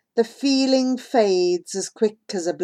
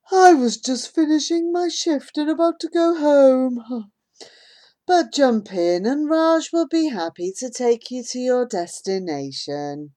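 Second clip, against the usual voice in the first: lilting, higher friendly driver; sing-song driver voice; 4 semitones higher than usual. A narrator's reading. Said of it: "A sing-song voice".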